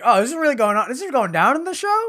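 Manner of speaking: high, loud incredulous gasp of a line; shocked disbelief. Retold or imitated. Viewer reacting to a shocking anime scene, high and loud. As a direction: react like you can't believe what you're seeing. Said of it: "I was like".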